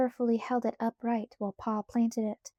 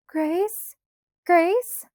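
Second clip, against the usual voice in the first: high searching call with a rising lift; 8 semitones higher, 7 dB louder than usual. A narrator's reading. Mary calls from the shanty doorway, high and searching.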